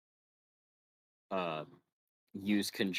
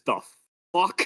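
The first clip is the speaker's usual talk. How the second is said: loud, high-pitched burst of disbelief; stunned outburst; 12 semitones higher, 11 dB louder than usual. Acted. [name] blurts at the Jedi reincarnating, a loud high shocked outburst. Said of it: "[name] just goes".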